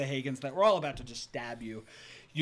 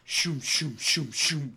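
shooting sound effects; 4 dB louder, slower than usual. Acted.

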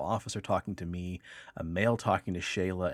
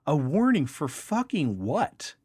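slower, higher and louder, hammed-up outrage; mock the wife snapping back at the cop; imitating an outraged retort; indignant wife; 7 semitones higher, 5 dB louder, slower than usual. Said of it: "She's the bad cop".

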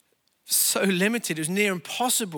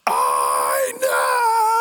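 full-force yell, much louder and lower; huge shout; 7 dB louder than usual. Retold or imitated. Stressed husband roaring at his wife over the missed turning, furious shout. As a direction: explode at her in a roar. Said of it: "shouted about as loudly as I ever shouted".

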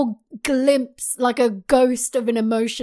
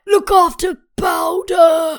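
dying father's plea; 6 semitones higher, 4 dB louder, slower than usual. Retold or imitated. Dying Vander voiced.

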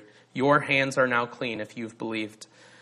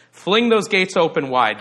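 raised, louder joyful call; 5 semitones higher, 6 dB louder than usual. Acted. Procession announcing its arrival at the gates, calling out. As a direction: call ahead to open up.

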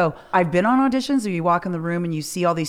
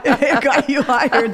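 loud, high, laughing through the words; 4 semitones higher, 6 dB louder than usual. Real, not acted. Host reacting to guest's story, laughing. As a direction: crack up while talking.

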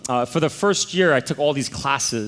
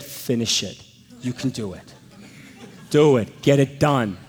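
stern dean; 2 semitones lower than usual. Retold or imitated. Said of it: "and he said".

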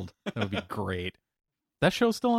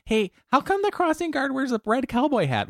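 put-on high curious kid; squeaky child asking; little kid voice.

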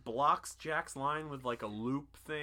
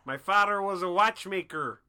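higher, louder hammed-up New York accent; a blue god telling his origin in a thick New York accent; mock New York accent; 7 semitones higher, 10 dB louder than usual. Continuing the New York god bit.

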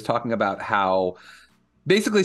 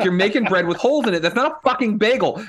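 higher, heated rant at the screen; mock yell at the TV; 8 semitones higher than usual. Retelling yelling at the bagel baker on TV, mock angry. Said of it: "yelling at the television".

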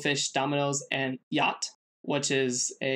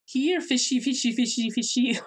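high, loud sing-song taunt; Mario; 10 semitones higher, 3 dB louder than usual. Retold or imitated. Host mimics Mario playing Go Fish, high taunting call. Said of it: "Mario has, has a tendency to just go".

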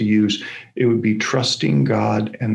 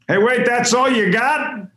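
yelled call from the back; 11 semitones higher than usual. Retold or imitated. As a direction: shout it out from the back of the room. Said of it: "that yells out".

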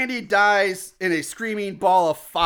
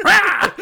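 loud, high comic battle yell; mock attack cry; 12 semitones higher, 8 dB louder than usual. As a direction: pounce with a hammed-up roar. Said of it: "She's like".

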